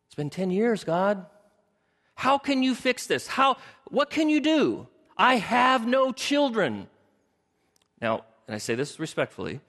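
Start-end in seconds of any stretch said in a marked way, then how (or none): 0.1-6.9 high, exasperated complaint, drawn out; exasperated Abram. Acted. Preacher playing Abram as he complains to God, high and exasperated. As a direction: whine it up at God, stretching the words.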